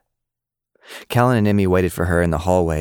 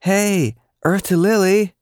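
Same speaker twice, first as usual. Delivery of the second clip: calling out; 14 semitones higher, slower than usual. A narrator's reading. Friend calls a daydreaming Lily.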